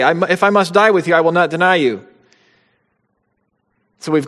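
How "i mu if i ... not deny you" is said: higher, louder and fast, insisting hard; Peter's emphatic promise to Jesus, fast and loud; emphatic vow. Retold or imitated. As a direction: swear it with full conviction.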